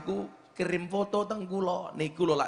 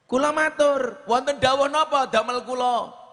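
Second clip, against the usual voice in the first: higher and louder, urgently asking; anxious raised voice; 10 semitones higher, 7 dB louder than usual. Retold or imitated. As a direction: ask like a worried student begging to know how to help. Retold own anxious question.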